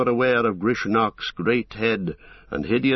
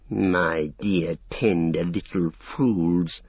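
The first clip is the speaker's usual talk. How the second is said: very slow, menacing mock-tender drawl; hissing orc; 3 semitones lower, much slower than usual. A narrator's reading. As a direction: draw out each word with sinister sweetness. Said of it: "hissed Grishnach".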